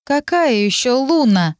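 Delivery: high, slow puzzled question; bewildered. Acted. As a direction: ask it baffled. (недоуменно)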